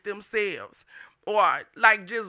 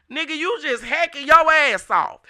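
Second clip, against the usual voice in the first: higher and louder, scolding the hacker; angry call-out; 6 semitones higher, 8 dB louder than usual. Real, not acted. Vlogger yelling at the hacker who took his page. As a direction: call him out loud and high.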